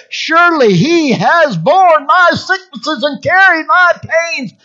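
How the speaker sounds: high, forceful preaching shout; triumphant declaration. Shown as a demonstration. Preacher finally there after meditating on the verse, triumphant shout. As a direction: proclaim every word at full force.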